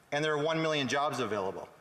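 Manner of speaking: normal talking voice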